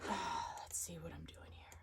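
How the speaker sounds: soft whisper to herself. Real, not acted. Whispering to herself while checking the screen. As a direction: breathe it out under her breath.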